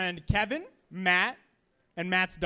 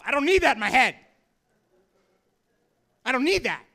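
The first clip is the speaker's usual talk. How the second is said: loud, higher exasperated outburst; exasperated protest; 4 semitones higher, 8 dB louder, faster than usual. Acted. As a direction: yell it in mock alarm. Exclaimed punchline about raccoon fear.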